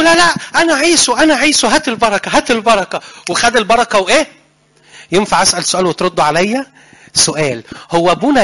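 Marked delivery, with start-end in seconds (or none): 0.0-3.0 pleading insistence. Acted. Jacob insisting on blessing.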